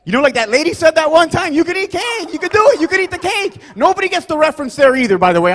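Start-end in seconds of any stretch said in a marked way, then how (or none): 1.5-3.6 very high, hammed-up cry; screaming. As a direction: call out shrieky-high like a royal proclamation. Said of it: "I'm just screaming".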